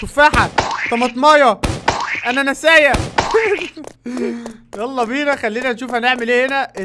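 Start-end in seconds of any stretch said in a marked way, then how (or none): 0.0-2.9 loud, playful nonsense; silly playful words. Real, not acted. Playful silly words while shooting. 3.0-4.7 amused laugh. Real, not acted. Laughing at own joke.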